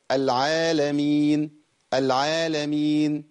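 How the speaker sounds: العالمين with alif held evenly two counts; correct natural madd. Shown as a demonstration. Teacher showing balanced vowel length. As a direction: draw out العالمين naturally, unforced. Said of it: "لا أتكلف".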